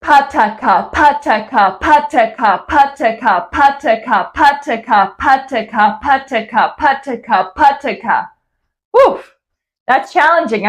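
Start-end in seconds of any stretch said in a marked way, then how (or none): 0.0-8.3 pataka repeated crisply and louder in a steady rhythm; crisp over-articulated pataka in an even beat; clear pataka drill. Shown as a demonstration. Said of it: "put all three together for pataka". 8.9-9.3 a high, loud breathless woof after the drill; real out of breath. Real, not acted. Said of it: "I ran out of breath at the end".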